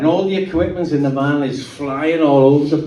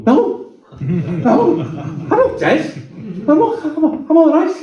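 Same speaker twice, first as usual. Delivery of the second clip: laughing disbelief; 13 semitones higher, slower than usual. Retold or imitated. Dazed driver answering after crash.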